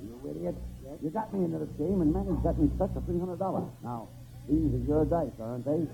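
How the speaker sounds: ordinary talk, nothing marked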